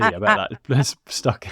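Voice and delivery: laughs mid-line, slow and high; teasing through a laugh; giggling tease about the hair. Real, not acted.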